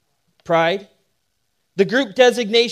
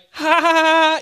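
high, hammed-up ha ha ha; mock laughter; 8 semitones higher than usual. Pastor mimics teens laughing at his joke, high mock laugh. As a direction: imitate the kids cracking up, pitched high.

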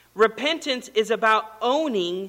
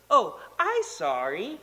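put-on slow, sing-song little-kid apology; apologetic toddler; slower than usual. Three-year-old to the cat he nearly cut off, apologetic toddler. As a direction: imitate a small child being sweetly sorry.

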